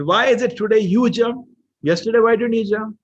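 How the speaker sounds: high, loud acted confrontation; challenging question. Minister confronting king over his jump, loud challenging question.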